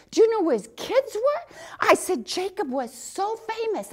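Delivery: bursting with enthusiasm; excited enthusiasm. Retold or imitated. Telling the soldier about Jacob, excited and eager. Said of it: "that excitement and enthusiasm".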